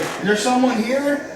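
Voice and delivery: high, slow, mock-scared question; nervous question. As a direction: tiptoe the question as if spooked. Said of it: "She's like".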